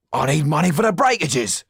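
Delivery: high, loud complaint; shouting after the carriage. Acted. Tull shouts after the carriage for money, high and loud. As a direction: yell it at the vanishing carriage. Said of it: "shouted Mister Tull".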